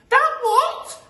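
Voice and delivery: loud, very high falsetto shriek; Missus Twit screaming. Acted. Missus Twit hearing of the giant wiggler, screaming. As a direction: shriek in horrified disbelief. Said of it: "screamed Missus Twit".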